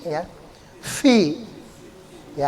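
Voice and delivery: ordinary talk, nothing marked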